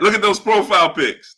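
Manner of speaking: ordinary talk, nothing marked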